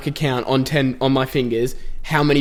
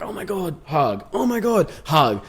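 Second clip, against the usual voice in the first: mock gushing; 3 semitones higher, slower than usual. Someone going around hugging everyone, mock gushing. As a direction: imitate an eager hugger going round the room.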